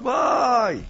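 high, drawn-out wail, falling away; mock cry of alarm. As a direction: imitate a girl crying out in dismay, not at full volume. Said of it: "והתחילה לצעוק".

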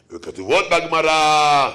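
mock old-time sermon chant; droning old preacher. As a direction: stretch it out like a tedious preacher. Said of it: "דרשנים שלפני מאה שנה".

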